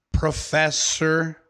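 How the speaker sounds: ordinary talk, nothing marked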